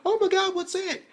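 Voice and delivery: very high squeal of alarm; mock panicked high cry; Mako's shocked squeal. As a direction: squeal it like she can't believe her eyes. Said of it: "Mako's like".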